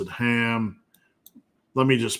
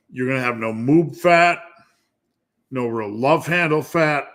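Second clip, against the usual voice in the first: ordinary talk, nothing marked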